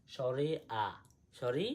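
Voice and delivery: usual speaking voice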